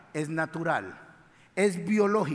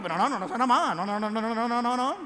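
mocking whine; 2 semitones higher than usual. Mocking the sisters' complaint.

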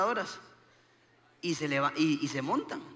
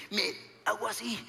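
ordinary delivery

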